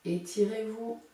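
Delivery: soft, slow guiding voice; calm relaxation cue; guided meditation. Acted. Leads out of relaxation.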